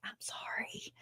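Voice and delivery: soft whispered "I'm sorry"; whispered apology. Real, not acted. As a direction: whisper the "I'm sorry" under your breath. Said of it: "I'm more triggered than I thought".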